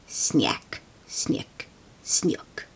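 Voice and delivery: whispered boot crunch sounds. A narrator's reading. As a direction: whisper each snick.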